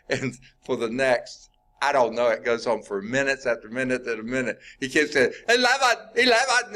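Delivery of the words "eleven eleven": high, loud mock Scottish shout; Scottish brogue. Scottish guy stuck repeating himself to the elevator, loud Scottish brogue. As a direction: yell it like an exasperated Scotsman. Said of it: "in a very heavy Scottish brogue".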